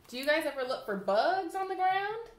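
ordinary delivery, nothing marked

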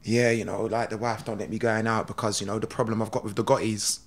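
low, soft, understated star voice; Steven Seagal. Retold or imitated. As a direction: imitate him confiding it casually. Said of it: "then Stephen's gone".

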